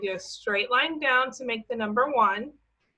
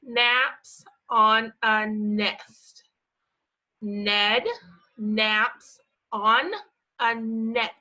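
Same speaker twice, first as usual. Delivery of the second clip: each word of "naps on a nest" slow and separate; slow reading; much slower than usual. Shown as a demonstration. Teacher reads a sentence slowly for kids. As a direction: read it out word by word. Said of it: "on the bottom we have Ned".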